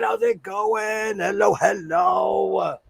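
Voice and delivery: lazy drawn-out welcome; slow sing-song greeting. Real, not acted. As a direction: stretch the words out, slow and high. Repeated greeting, stretched out.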